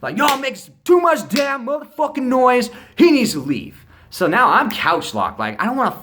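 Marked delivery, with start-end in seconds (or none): 0.2-3.8 high, loud mock tirade; hammed-up angry dad rant; imitating a pissed-off dad. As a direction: play the furious dad yelling at the kids. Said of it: "right, super pissed off".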